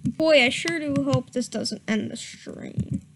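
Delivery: plain reading voice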